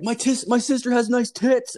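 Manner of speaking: high, loud call with an odd voice; yelling a prank line to the team leader; yelled over noise. Retold or imitated. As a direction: holler it across the loud floor. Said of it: "I started yelling".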